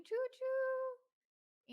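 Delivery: high, loud "choo choo"; playful train toot, long and high; train whistle call. Pretend. Train whistle cheer welcoming member.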